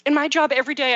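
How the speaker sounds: usual speaking voice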